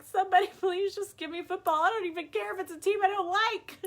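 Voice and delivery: high, fast, loud begging; mock desperate plea. Fan begs for any football at all, mock desperate. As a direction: beg in hammed-up desperation.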